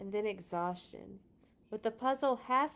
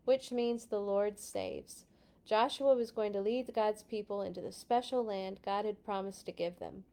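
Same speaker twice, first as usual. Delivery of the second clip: ordinary delivery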